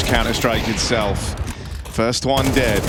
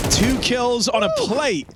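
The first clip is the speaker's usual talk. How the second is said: high, hyped caster call; excited play-by-play; 9 semitones higher than usual. Real, not acted. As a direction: ride the rising action.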